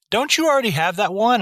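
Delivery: high-pitched questioning grandma; retold grandmother. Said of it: "and her being like".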